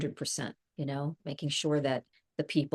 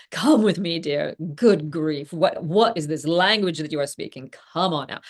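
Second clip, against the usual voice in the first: loud, imperious character scolding; Pamela Curtis; 8 dB louder than usual. Acted. Actress as her soap character hurrying Lydia along, loudly exasperated. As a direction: take charge with exasperated grandeur. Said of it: "I think she would be like".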